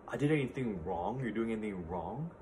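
normal talking voice

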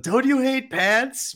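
very high, slow cartoon impression; Homer Simpson. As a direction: stretch it out as a high-pitched comic quote. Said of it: "is the Homer Simpson, like".

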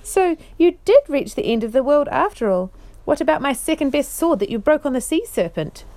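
loud, high, delighted teasing; astonished joy. Acted. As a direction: laugh it out at an old friend. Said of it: "He gave a great laugh of astonished joy".

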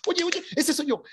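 high, eager "that's me!"; excited claim. Retold or imitated. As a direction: burst out with joyful recognition. Tells wife excitedly.

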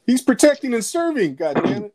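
mock outrage. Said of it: "I was like".

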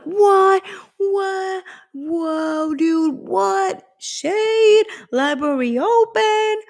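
very high, drawn-out incredulous squeals; mock shocked outburst. Viewer reacting to Emma's insult, mock shocked.

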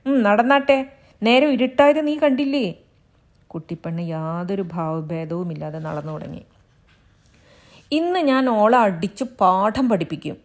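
lightly voiced angry husband, a touch of bluster. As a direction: bluster that he will beat her. Husband's mock threats.

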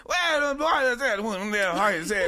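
mumbling old grandpa impression; hammed-up rambling old man; old granddad voice. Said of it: "this is what it is, my granddad".

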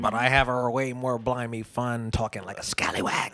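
slow, hammed-up pirate brogue. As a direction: talk like a playful old scallywag pirate. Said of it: "Yo went pirate on him".

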